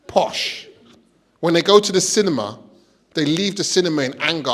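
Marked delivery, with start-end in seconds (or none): 0.1-0.7 whispered posh. Pretend.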